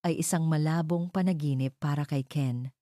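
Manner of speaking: usual speaking voice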